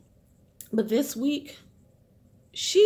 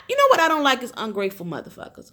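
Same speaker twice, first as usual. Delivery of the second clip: ordinary delivery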